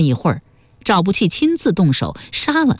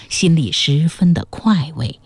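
plain reading voice

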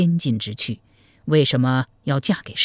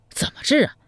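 eager; 8 semitones higher than usual. Acted. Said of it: "迫不及待地问".

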